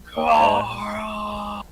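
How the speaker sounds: long, drawn-out high bellow; acted raging battle cry; yelling out. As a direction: bellow the name like a berserker. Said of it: "then he starts yelling out".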